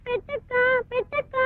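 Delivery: crying whimper. Acted. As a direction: whimper for food through tears. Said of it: "ఏడవద్దమ్మా". (ఆకలితో ఏడుస్తూ బతిమాలే చెల్లెలు)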